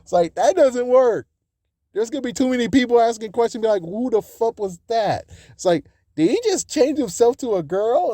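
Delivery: high, fast disbelief; incredulous rant. Real, not acted. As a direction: rattle it off baffled. Incredulous rant about plot holes.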